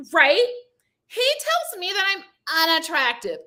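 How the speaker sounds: high, affected mock voice; mocking Alexis. Imitating Alexis calling out Colby: high mocking voice. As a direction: lightly parody her prim interruption. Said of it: "Alexis chimes in and she's like".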